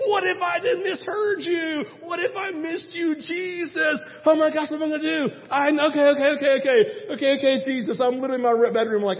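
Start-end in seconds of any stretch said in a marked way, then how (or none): 0.1-8.0 frantic prayer. Retold or imitated. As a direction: plead in a rush of nerves, much higher. Retold panic praying.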